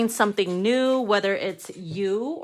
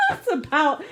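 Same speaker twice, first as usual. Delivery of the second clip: laughing; 10 semitones higher than usual. Real, not acted. Laughs at own alien joke.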